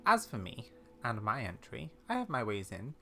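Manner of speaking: put-on wry, measured old lady with secrets; old lady character. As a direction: confide like a knowing elderly explorer, softly.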